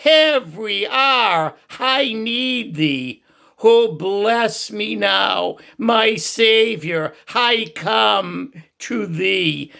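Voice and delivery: high, slow, pleading prayer voice; drawn-out, high prayer as an elderly churchman; heartfelt prayer. Retold or imitated. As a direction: lift it up slowly as a fervent old man's prayer. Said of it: "with all of his heart, he said this as a prayer".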